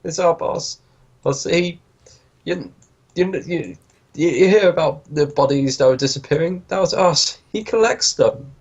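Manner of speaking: high, halting henchman voice; captive spilling the beans. Acted. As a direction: stammer out the confession, pitched high. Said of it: "starting to spill the beans".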